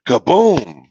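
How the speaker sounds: loud kaboom with a rise and fall; booming thunder. A narrator's reading. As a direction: boom it out like a big noise. Thunder's big noise.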